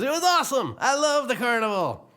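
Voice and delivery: high, delighted country voice; thrilled Mater. Acted. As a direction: gush like Mater. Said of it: "Mater says".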